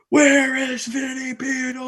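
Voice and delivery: high, slow drawn-out scream of the name; imitated scream. Bam screaming for him across the New York street, high mock yell. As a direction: yell it high and stretched like a raging man. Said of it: "I just hear Bam screaming".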